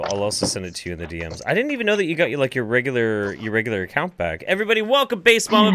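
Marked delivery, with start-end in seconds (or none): 4.4-5.8 excited announcing. Real, not acted. Host welcoming a guest.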